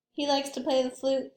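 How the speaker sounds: plain reading voice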